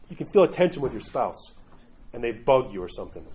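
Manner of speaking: ordinary talk, nothing marked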